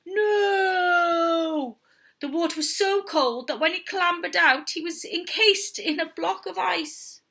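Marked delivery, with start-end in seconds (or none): 0.1-1.8 high falling cry of dismay; shouted no. Acted. Man crying out as he falls into the sea. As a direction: yell as you tumble.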